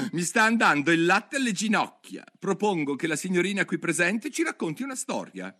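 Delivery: high, bored hare voice; a bored hare pushing Alice; March hare. Acted. March hare demanding a story, high and bored.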